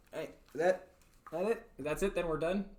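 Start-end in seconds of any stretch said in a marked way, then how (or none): none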